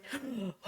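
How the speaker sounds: sharp high shocked gasp; imitated gasp of disbelief. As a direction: suck in air in shock. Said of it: "I was just like".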